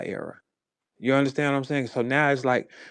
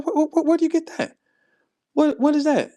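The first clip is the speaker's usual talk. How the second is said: high, louder, tripping over the first word; stammering amazement; 13 semitones higher, 4 dB louder than usual. Retold or imitated. Comic asking her where she got that, high stammering amazement. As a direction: stutter excitedly into a high question.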